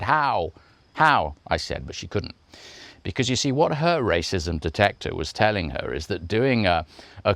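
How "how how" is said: higher, punchy repeated question; indignant challenge. Retold or imitated. Voiceover artist challenging the producer, higher indignant demand. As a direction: demand an explanation, twice over. Retold defiant reply to producer.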